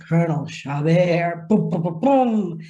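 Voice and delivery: slow, theatrical name reveal, then booming drum hits; hint of a stage herald with boom sound effects; dramatic announcement. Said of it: "all of a sudden he says".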